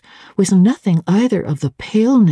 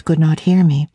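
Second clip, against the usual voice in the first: ordinary delivery, nothing marked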